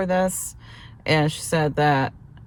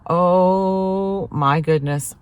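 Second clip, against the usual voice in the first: long drawn-out "oh"; retold groan of dread, stretched out slowly; mock desperation; 3 dB louder, much slower than usual. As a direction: act out barely holding on. Retells her desperation while waiting.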